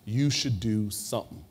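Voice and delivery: slow, firm, knowing reply as Miss Jones; firm old lady. Retold or imitated. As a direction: lay it down slowly, arms crossed. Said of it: "she crosses her arm and says".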